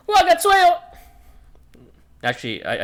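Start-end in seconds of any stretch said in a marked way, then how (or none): 0.1-0.8 very high, loud name yell; Wagatsuyo. Acted. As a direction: hurl the name out in character. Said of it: "I need to channel his voice a bit more".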